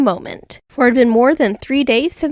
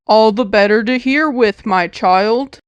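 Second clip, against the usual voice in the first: put-on a wolf coaxing the girl into bed; luring wolf; 2 semitones lower than usual. A narrator's reading. Wolf voiced in story.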